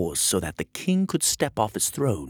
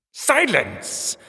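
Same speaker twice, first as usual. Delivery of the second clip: high shrill command; booming; 13 semitones higher, 4 dB louder than usual. Acted. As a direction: bark it over the room. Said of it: "The king's voice boomed".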